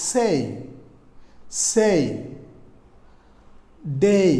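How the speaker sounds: "say" and "day" with a stretched ay-ee glide; long ay vowel. Shown as a demonstration. Teacher gives example words for letter A, stretched gliding vowel. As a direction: draw out each word, isolated and deliberate. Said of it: "যেমন in words যদি বলি".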